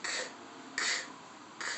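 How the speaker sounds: clipped "K, k, k" letter sounds; sounding out words. Shown as a demonstration. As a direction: tap out "k" as a hard consonant sound. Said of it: "Let's sound out cat".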